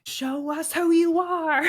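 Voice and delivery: high, slow, taunting dare; mock challenge. To the witness on the stand, a high slow taunting dare. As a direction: drag the mask off her, slowly. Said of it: "I just wanted to be like".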